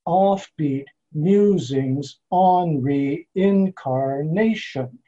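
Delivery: "Offbeat Musings on Reincarnation" very slow, each first syllable hit hard; OFF-beat MU-sings on RE-in-car-NA-tion, drawn out; exaggerated trochaic stress. Shown as a demonstration. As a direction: spell out the strong-weak beat, slowly. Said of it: "if we exaggerate this".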